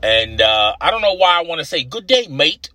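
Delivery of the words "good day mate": lightly attempted Australian greeting; a casual, half-hearted Aussie accent. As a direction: slip briefly into a mild Australian accent. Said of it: "'cause that's Australia".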